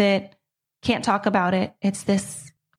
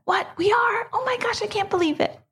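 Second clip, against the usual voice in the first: put-on much higher, squealing disbelief of a thrilled girl; excited daughter; 12 semitones higher than usual. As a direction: imitate her daughter bursting with joy. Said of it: "She was so excited".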